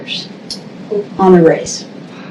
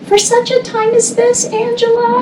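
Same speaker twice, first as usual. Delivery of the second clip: much higher, hammed-up solemn prophecy voice; mock dramatic friend; 4 dB quieter than usual. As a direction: tease her with a lofty, sing-song higher voice. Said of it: "and she goes".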